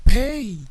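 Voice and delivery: popped p in Pay; plosive p. Shown as a demonstration. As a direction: Pay with a loud burst on p. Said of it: "P-nya itu plosive".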